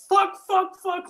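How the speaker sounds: high-pitched imitated scream. Imitating a friend running into freezing sea, high scream. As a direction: scream curses like someone hitting freezing water. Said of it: "running across the beach screaming".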